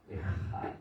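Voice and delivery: Ejad with a harsh throaty jota, almost whispered; strong Spanish J. Shown as a demonstration. Pastor demonstrating the Spain-style strong J in Ejad. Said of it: "es una J fuerte".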